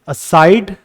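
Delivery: slow "aside" as a-SIDE; second-syllable stress. Shown as a demonstration. Teacher shows learners where stress falls, "aside" stressed on its second syllable. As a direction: lean slowly on SIDE in "aside". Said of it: "second syllable पे आ रहा है".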